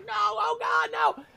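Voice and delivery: high, frantic yelled no; panicked shout. Retold or imitated. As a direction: cry out in horror. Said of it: "I'm like".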